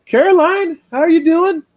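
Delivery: high, bright-voiced hello to a friend; greeting a viewer in the live chat, excited; cheerful greeting. Real, not acted. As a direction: call out happily to a buddy.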